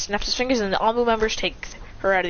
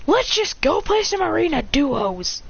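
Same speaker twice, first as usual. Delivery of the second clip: exasperated gamer; 8 semitones higher than usual. Acted. Gamer gives up the quarrel.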